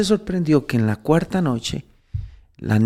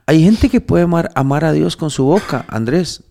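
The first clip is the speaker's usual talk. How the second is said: rising sermon fervor, voice pushed up high; fervent preaching; 6 semitones higher, 5 dB louder than usual. Acted. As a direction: preach it hard, pressing each claim. Preaching to Andrés.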